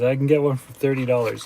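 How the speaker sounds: ordinary talk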